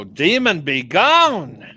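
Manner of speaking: loud, high faith-healer shout; hammed-up exorcism command; mock exorcist. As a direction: cast out the demon with a big drawn-out cry. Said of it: "lay hands on her and go".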